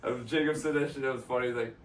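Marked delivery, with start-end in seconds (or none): none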